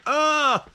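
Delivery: sharp high yelp of cramp pain. Real, not acted. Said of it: "my foot's cramping".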